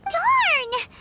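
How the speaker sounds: high, sudden cry of disappointment; frustrated darn. Acted. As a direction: blurt it out, thwarted. Paimon frustrated by dead end.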